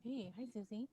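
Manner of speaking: faint, distant mic sound; barely audible. Real, not acted. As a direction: voice lost far from the microphone. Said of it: "I can barely, barely hear you".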